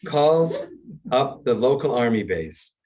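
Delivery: normal talking voice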